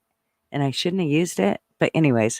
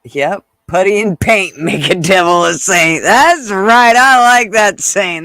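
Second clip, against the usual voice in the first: loud enthusiasm; 2 semitones higher, 8 dB louder than usual. Real, not acted. Reading a viewer's saying, loud and delighted. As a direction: belt out your approval. Reacting to a chat comment.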